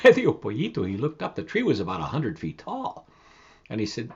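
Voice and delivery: usual speaking voice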